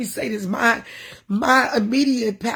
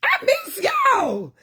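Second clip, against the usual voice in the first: tearful cry out; 13 semitones higher, much slower than usual. Real, not acted. To her followers after time away, voice breaking high. Emotional live video to fans.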